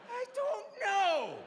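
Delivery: very high, slow sobbing whine; crying whine. Retold or imitated. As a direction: whimper helplessly. Patient whines.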